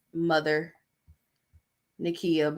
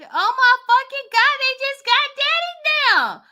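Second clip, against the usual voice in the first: much higher, frantic mock panic; panicking relative; 5 dB louder than usual. Vlogger voices the scared family member, high frantic mock panic. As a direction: squeal it out like she is next in line.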